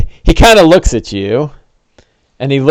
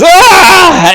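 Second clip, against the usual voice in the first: much higher, louder yelp of pain; pained cry; 5 dB louder than usual. Acted. NPC struck by sacred flame, pained cry. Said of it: "He goes".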